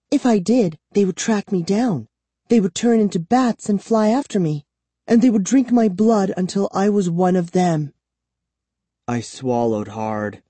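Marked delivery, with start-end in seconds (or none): 0.1-7.9 put-on high, urgent girl's voice; fearful captive girl. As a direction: warn of the horrors, pitched high.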